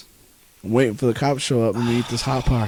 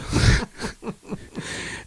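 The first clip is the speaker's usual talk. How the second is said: high laugh; 4 dB quieter than usual. Real, not acted.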